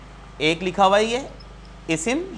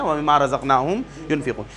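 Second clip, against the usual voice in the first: verse recitation; 5 semitones lower than usual. Acted.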